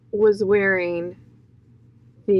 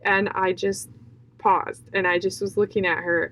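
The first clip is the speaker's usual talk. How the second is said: ordinary talk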